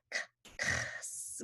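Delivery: voiceless "k-", then "kss"; throat-clearing K alone, then sliding into S; X sound. Shown as a demonstration. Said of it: "that clearing throat sound again".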